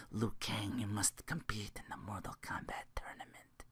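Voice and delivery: whispered Lambert-style line; a hint of Christopher Lambert in a whisper; Christopher Lambert impression. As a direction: breathe it out as the movie mentor. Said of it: "Christopher Lambert laugh".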